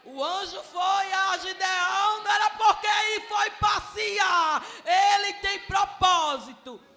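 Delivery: very loud, high, slow shouted preaching; preaching at full force. Real, not acted. Preacher proclaiming the angel's purpose to the congregation, full-force shout. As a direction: shout it out to the congregation, drawing out each phrase.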